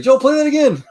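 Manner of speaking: high, loud eager command; excited band director. Retold or imitated. Director to Joel with phone out, high loud eager shout. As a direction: call it out, thrilled. Director demands replay again.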